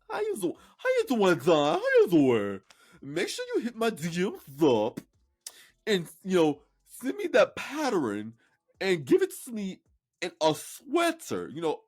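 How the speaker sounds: silly put-on voice. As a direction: greet her in a goofy put-on voice. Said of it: "I don't know why I'm speaking like that".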